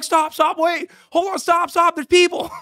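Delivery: high, loud shout to halt someone; frantic high-pitched calling out; urgent yell. Retold or imitated. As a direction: yell it like stopping a closing door. Retells yelling at gate agent to stop.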